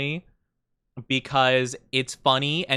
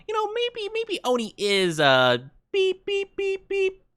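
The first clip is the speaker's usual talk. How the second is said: censor beep. Pretend. Censor beeps.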